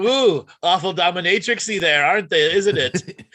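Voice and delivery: high, loud, slow comic disapproval; mock scandalised. Co-host reacting to leather bodysuits, mock scandalised. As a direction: exclaim it high, breaking into a laugh.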